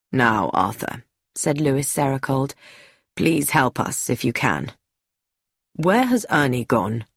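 Lewis, low and firm. A narrator's reading. Lewis questions the boy.

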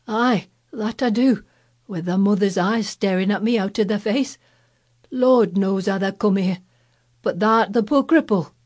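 put-on lightly shaky Yorkshire old man; bewildered old gardener, slightly wavering; queer shaky voice. A narrator's reading. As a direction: answer unsteadily in dialect. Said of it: "answer in a queer, shaky voice".

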